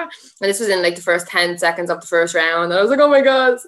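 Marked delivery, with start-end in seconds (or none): 3.0-3.6 louder, alarmed gasp of words; shocked exclamation. Retold or imitated. Injured fighter retelling the moment, loud alarmed exclamation. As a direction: blurt it as the pain hits.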